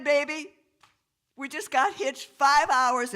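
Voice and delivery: high, loud excited stoner voice; stoned driver. Acted. Stoned newlywed leans out to the cop, high loud gushing. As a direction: crow it high, barely coherent. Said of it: "Marshall, barely able to speak".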